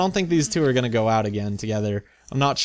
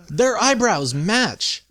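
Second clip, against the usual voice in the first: excited; 9 semitones higher, 3 dB louder, slower than usual. Real, not acted. Delighted discovery.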